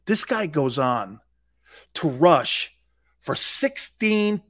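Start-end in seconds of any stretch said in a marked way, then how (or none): none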